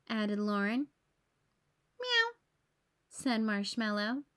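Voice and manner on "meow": high little storybook meow; cat meow. A narrator's reading. Marshmallow the pet joins the children, cat meow. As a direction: mew it like a pet, pitched way up.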